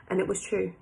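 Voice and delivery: usual speaking voice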